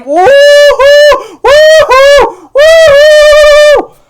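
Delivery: very high, very loud cheering scream; screamed woohoo. Retold or imitated. Fan cheering the on-screen kiss, screaming. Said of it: "and scream".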